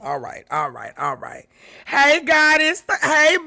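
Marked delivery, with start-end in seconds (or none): 1.8-3.5 bright raised call to a viewer; excited greeting. Real, not acted. Streamer greets a viewer by name, excited.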